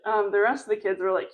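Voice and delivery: ordinary talk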